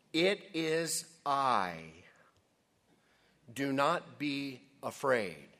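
slow, loud, weighty declaration; commanding quote. Acted. Preacher quoting Jesus to the frightened disciples, slow and commanding. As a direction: proclaim it with space round each word.

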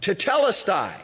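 high, loud triumphant cry; victory shout. Shown as a demonstration. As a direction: shout it out as a win. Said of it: "he shouted".

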